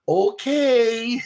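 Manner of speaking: loud, very high okay; playful high reply. Retold or imitated. Replying to the mother's friend, loud high playful voice.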